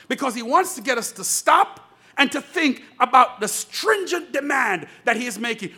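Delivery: ordinary talk, nothing marked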